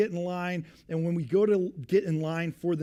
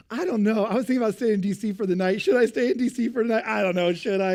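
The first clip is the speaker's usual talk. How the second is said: loud, higher, whiny indecision; dithering lady; 5 semitones higher, 7 dB louder than usual. Retold or imitated. Mimicking the indecisive woman at the counter, loud and whiny. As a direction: play the chatty woman who can't decide.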